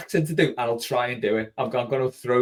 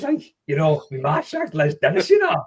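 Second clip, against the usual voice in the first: comic North-East impression, rising high at the end; hammed-up comedian impression, climbing in pitch; Vic Reeves; 4 semitones higher, 3 dB louder than usual. Shown as a demonstration. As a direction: drop into a comedian's catchphrase voice. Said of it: "I always default to that, like, you know, Vic Reeves".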